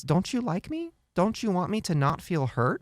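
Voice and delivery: high, soft, wounded pleading; mock-pitiful questioning; played-up hurt. Shown as a demonstration. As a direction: plead like a hammed-up hurt parent. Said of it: "kinda play it up like that".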